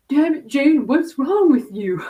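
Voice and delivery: loud, high exasperated scold with a laugh; self-scolding. Retold or imitated. Jane catching herself and scolding herself, loud exasperation. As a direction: snap at yourself half laughing.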